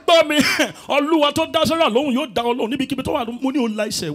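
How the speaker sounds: high loud fast preacher command; shouting Yoruba pastor. Retold or imitated. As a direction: bark it like a fiery pastor.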